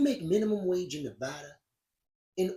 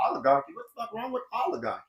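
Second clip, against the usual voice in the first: confused listener; 5 semitones higher, faster than usual. Retold or imitated. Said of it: "Most people heard oligarchy and said".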